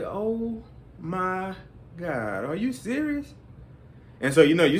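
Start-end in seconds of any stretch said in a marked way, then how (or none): 0.0-3.3 soft, slow, incredulous; disbelief. Retold or imitated. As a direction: mutter it in stunned disbelief. Son's disbelief at mother.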